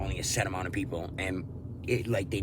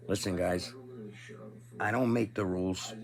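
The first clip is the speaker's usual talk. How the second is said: ordinary talk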